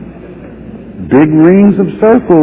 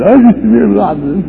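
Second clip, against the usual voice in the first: mock complaint; 6 semitones higher than usual. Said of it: "People don't really talk like that".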